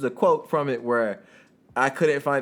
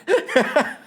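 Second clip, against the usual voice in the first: a real high-pitched burst of laughter; high giggle; 6 dB louder than usual. Real, not acted. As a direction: laugh out loud at your own screw-up. Laughing at being caught.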